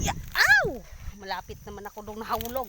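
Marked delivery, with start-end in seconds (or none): 0.3-0.8 loud, high "ow" scream; sudden full-voiced cry of alarm; startled yelp. Real, not acted. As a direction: yelp as you nearly fall off. Nearly falls off the carabao.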